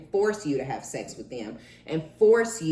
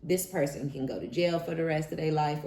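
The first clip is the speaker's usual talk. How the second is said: ordinary delivery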